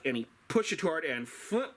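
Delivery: ordinary delivery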